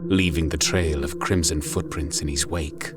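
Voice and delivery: usual speaking voice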